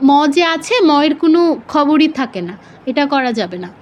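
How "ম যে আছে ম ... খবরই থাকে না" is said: ordinary talk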